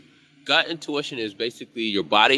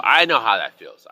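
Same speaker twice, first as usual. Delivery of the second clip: ordinary delivery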